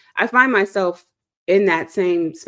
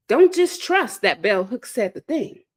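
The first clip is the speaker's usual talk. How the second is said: ordinary delivery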